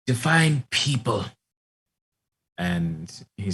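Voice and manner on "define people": put-on higher-pitched old Nana voice; suspicious grandma. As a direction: answer warily as the grandmother. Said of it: "And she says".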